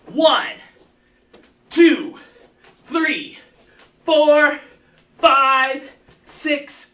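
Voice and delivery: loud, high counting of reps; energetic coach calling out reps; shouted count. Real, not acted. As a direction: bark out each number to drive the class.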